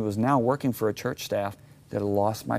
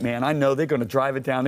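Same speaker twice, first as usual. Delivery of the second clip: ordinary delivery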